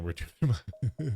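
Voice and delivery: laughing through the words, slowed right down. Real, not acted. Laughing at the sassy tea-shop choices, breaking into laughter. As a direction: crack up mid-line.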